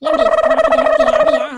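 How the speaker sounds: loud scolding yell at a friend; sharp loud rebuke; scolding shout. Acted. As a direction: shout her down in reproach. Scolds friend for wanting beauty.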